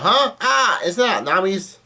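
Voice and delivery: high-pitched, drawn-out mock cry of surprise; mock surprised yell. Recapping Usopp seeing Nami's clothes, mock surprised yell. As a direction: imitate a startled cry, high and slow. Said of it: "And Usopp yells out".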